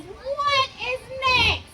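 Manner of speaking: a lightly voiced sleepy cow. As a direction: draw it out like a slow cow. Cow voiced in read-aloud story.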